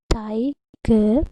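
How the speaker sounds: TIGER split into two beats, ti - ger; syllable by syllable. Shown as a demonstration. Teacher counting chin touches on the palm, syllable by syllable. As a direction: pronounce TIGER with a gap after "ti". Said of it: "When you pronounce the word".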